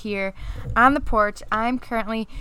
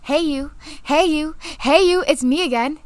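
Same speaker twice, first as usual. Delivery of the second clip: mock pestering; 5 semitones higher than usual. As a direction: keep poking for attention, rising each time. Mocking a pestering texter.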